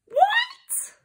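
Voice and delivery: very high, airy squeak of shock; whispery high-pitched double take; shocked disbelief. Acted. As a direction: gasp it out, rising sharply. Shocked at being a robot monkey.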